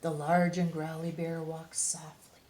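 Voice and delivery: lively picture-book read-aloud; reading to children. A narrator's reading. Reading a bear picture book to young listeners, animated storytime voice. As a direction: read it like storytime for little kids.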